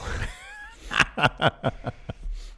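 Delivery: wheezing silent laugh; high breathless laugh. Real, not acted. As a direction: laugh without sound. Laughing at his story.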